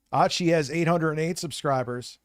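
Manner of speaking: normal talking voice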